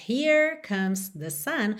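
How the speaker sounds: rhotic American R in "Here"; "[name]" with curled American R; American accent. Shown as a demonstration. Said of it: "ao invés de termos".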